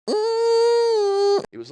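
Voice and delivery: very high yelling squeal; high shriek. Retold or imitated. Retelling the toy trick, very high squeal. Imitating the toy's noise.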